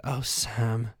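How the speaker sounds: a lightly voiced swooning Frodo; weird Frodo sigh. Comic mimicking Frodo, a hint of lovesick sighing. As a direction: sigh his name lovingly, a bit odd. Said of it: "Like the most weird way".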